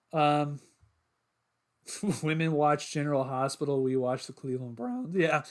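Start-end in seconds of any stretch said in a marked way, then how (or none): 4.8-5.4 the voice breaks mid-word; voice crack. Real, not acted. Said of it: "voice crack".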